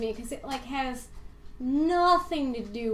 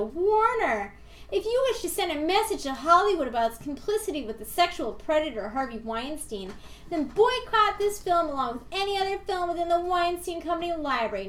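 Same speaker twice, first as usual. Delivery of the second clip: mock preachy announcer; 5 semitones higher, 4 dB louder than usual. Reading a preachy review aloud.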